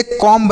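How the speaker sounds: "कॉम्ब" with the final b sounded; wrong pronunciation. Shown as a demonstration. Teacher warns students off sounding the b, wrong pronunciation. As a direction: voice the silent b in "कॉम्ब". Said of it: "यह silent है".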